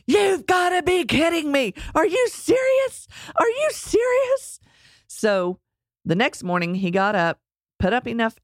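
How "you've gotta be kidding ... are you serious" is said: exasperated disbelief. Retold or imitated. Husband seeing another flat tire, high mock exasperation. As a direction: wail in exaggerated disbelief.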